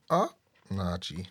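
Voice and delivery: normal talking voice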